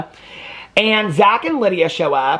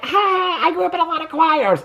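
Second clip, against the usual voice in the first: much higher, put-on taunting voice; mocking imitation; 11 semitones higher than usual. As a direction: parrot him like a schoolyard bully. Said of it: "they were just laughing at that more".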